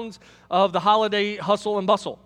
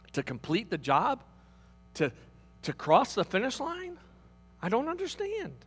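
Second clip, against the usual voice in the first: Moses pleading with God; 8 semitones higher than usual. Acted. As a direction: cry out to God, then let it sink to bewilderment.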